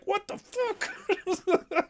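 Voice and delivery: very high, slow, laughing; laughing disbelief. Retold or imitated. His own reaction retold, very high and laughing. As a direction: stretch it out cracking up. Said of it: "I was like".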